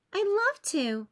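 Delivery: put-on high, bright-eyed little girl's yes; eagerly accepting; delighted high answer; thrilled. A narrator's reading. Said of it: "she tells Lioness".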